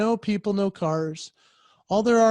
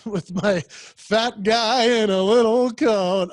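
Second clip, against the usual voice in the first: ordinary delivery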